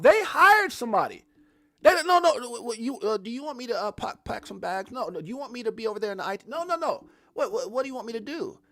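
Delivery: high, fast flustered voice; mock eager employee. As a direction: play a panicked worker asking what to do. Mock employee reacting to hiring.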